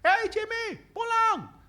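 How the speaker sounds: very high, loud calling-out yell from afar; maid yelling. Retold or imitated. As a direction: call the boy home across the field. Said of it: "pembantunya teriak". (pembantu memanggil Jimmy pulang, teriakan tinggi dan keras)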